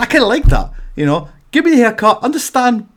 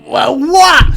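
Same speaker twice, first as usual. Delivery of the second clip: mock outburst; 7 semitones higher, 7 dB louder than usual.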